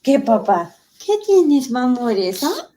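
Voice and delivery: put-on high playful baby-talk Spanish; voicing the baby. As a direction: speak for the baby, teasing and high. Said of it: "He was like".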